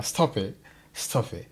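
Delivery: normal talking voice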